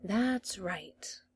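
soft breathy whisper, coaxing. A narrator's reading. The master coaxing the doctor, whispered. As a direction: whisper it to lure him in.

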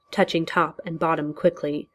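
ordinary delivery

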